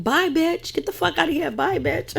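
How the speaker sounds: high, singsong retold taunt; mock taunting send-off. Acting out Jackie as B J leaves, mock taunting.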